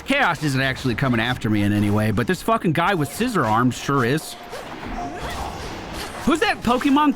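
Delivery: normal talking voice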